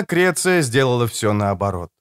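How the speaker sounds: ordinary delivery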